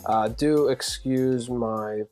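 hoarse, raspy throat; talking through a sore raspy throat. Real, not acted. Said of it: "please excuse my raspy voice".

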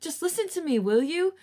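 snapping. A narrator's reading. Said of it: "Chan finally snapped".

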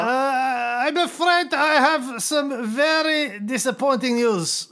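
put-on high-pitched German officer drifting into Japanese accent; mock German accent that sounds a bit Japanese; comic muddled German-Japanese accent; shaky German accent. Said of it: "I sound a little bit Japanese".

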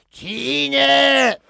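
loud, high, long-held squawked call; drawn-out parrot imitation, high and loud; parrot mimicking sick woman. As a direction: screech the name like a parrot copying its owner. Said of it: "The exact voice of the woman".

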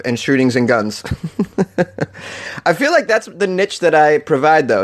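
ordinary delivery

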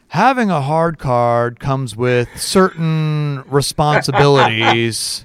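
slow, grave lecture from a stern director; imitating a stern boss, slow and weighty; stern official. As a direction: drag out each word like a solemn warning. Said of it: "and he's like".

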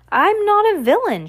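high, frantic protest; frantic cry. A narrator's reading. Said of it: "Jacques cried frantically".

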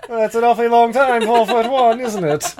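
quick, high, amused English gent. Acted. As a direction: tease like a jolly lord.

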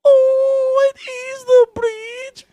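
slow, loud falsetto delight; very high mock wonder; cartoon kid. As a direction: squeal it out slowly like a cartoon explorer. Acting out Dora cartoon scene.

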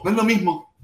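ordinary delivery

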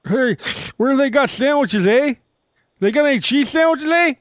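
sing-song mock Wisconsin voice ending on eh; mock Wisconsin accent. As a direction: lampoon a cheesehead asking for sandwiches. Mocking Packers fans.

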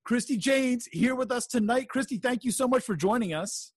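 high, lively show-opening announcer; bright event host. Acted. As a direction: welcome the crowd like a ring announcer.

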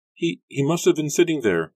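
high, loud, halting; frightened stammer. A narrator's reading. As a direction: stumble through it in shock. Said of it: "Rebecca stammered".

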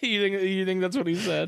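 normal talking voice

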